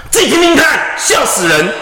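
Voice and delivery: high scornful yell; mocking shout. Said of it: "我就真的发飙".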